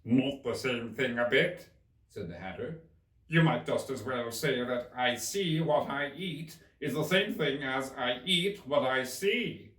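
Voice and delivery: drawn out and loud like the Hatter. Acted. Hatter remarking on Alice's hair, slow and loud.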